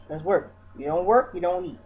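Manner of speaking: ordinary talk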